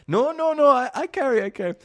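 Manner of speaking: high, loud burst of refusals, easing off; eager mock insistence. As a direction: imitate an excited buyer insisting. Eager buyer insists in broken English.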